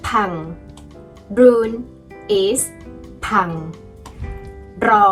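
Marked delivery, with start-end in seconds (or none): none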